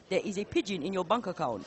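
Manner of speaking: comedy-sketch Nigerian accent at ordinary pitch; approximate Nigerian accent. Shown as a demonstration. Commentator trying a requested accent, rough Nigerian. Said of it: "approximate in Nigerian accent".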